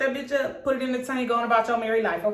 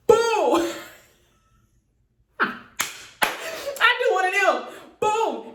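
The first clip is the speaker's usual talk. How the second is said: smack sound; 12 semitones higher, much slower than usual. Retold or imitated. Retelling the pimp smack on her baby daddy, high mock boom. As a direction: make the slap sound big.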